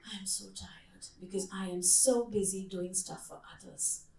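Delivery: slow, weary whispered complaint; tired whisper. Retold or imitated. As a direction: whisper it like someone worn out. Quoting tired, busy women.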